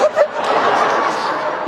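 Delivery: a high, breathless giggle with no voice behind it; high airy laugh. Real, not acted. Preacher cracking up at his own joke with a breathy giggle.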